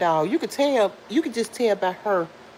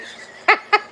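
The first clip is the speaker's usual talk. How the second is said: loud high laugh; 7 dB louder than usual. Real, not acted. Cracks up at joke.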